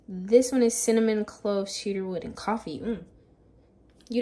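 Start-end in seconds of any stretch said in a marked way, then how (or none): none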